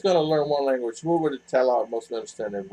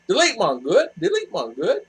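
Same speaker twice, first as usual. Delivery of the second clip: lightly mimicked, higher, rising lilt; imitating R R; 5 semitones higher than usual. Repeating what she says, in light imitation. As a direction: echo her phrase with a lift. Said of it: "And then she goes".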